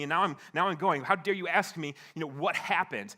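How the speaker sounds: heated retort building to loud, high outrage; flashing back angrily. Retold or imitated. Husband arguing with God about going back, loud acted outrage. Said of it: "I flashed back at him".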